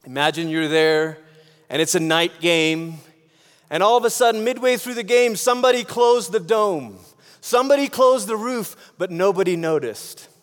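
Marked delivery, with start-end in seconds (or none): none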